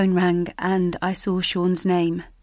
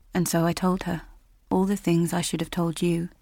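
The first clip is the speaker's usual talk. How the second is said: ordinary delivery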